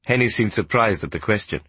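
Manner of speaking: ordinary delivery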